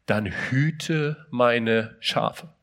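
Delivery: very slow, each word weighed; slow solemn quote. Acted. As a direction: let Jesus' command sink in slowly. Jesus quoted solemnly in sermon. (langsam und feierlich gesprochen)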